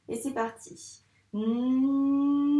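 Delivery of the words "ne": "Ne" louder, with a long hummed n and held e; sounding out syllable. Shown as a demonstration. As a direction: stretch n into e in "Ne". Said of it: "on entend le n et le e".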